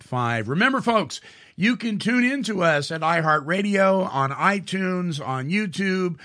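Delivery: upbeat radio host sign-off; bright, upbeat sign-off to the listeners; radio announcer. Acted. Radio show outro.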